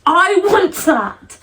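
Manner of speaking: high, drawn-out wish; eager longing. Real, not acted. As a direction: stretch it out with excited yearning. Longing for bookshop display dedication.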